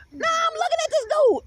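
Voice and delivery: high, incredulous retold aside; high incredulous inner voice; high mock disbelief. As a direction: squeak it out in disbelief.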